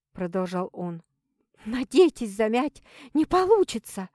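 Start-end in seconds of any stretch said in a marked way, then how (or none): 1.6-4.1 hissing, near hysteria. A narrator's reading. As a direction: hiss with hysteria breaking through. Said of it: "За шипением слышалась надвигающаяся истерика".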